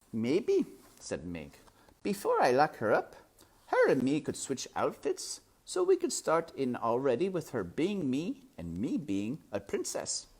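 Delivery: put-on simple girl chatting; serving girl. A narrator's reading. Mig voiced.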